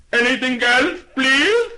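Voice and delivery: high, loud shouted shopkeeper; ear-splitting shout. Acted. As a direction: shout it so a deaf lady hears. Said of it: "lifted his voice to an ear-splitting shout".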